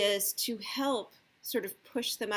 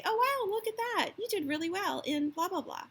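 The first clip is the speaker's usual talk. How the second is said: much higher, gushing praise; delighted praise; 9 semitones higher than usual. Shown as a demonstration. Parent praising child's grades, higher gushing praise. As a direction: cheer them on like a proud fan. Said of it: "you're just gonna say".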